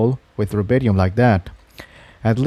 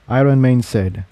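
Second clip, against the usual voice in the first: ordinary delivery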